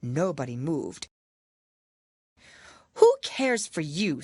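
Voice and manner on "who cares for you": defiant Alice. Acted. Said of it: "said Alice".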